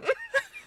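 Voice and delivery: high pained laugh; laughing in despair; whimpering laugh; laugh-cry. Real, not acted. Despair at memory.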